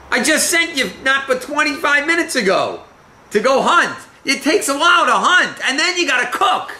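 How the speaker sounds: high-pitched, animated suspicion, voiced as a puzzled father; incredulous questioning. Acts out suspicious Isaac questioning his son.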